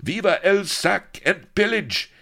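very high, loud soldier's cheer; high, loud rowdy cheer; enthusiastic cry. Acted. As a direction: cheer it out eagerly. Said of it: "enthusiastic cries".

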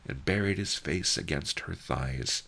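ordinary delivery

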